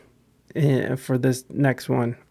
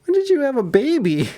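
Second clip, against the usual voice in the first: surprised people; 10 semitones higher than usual. Retold or imitated. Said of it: "people are gonna be like".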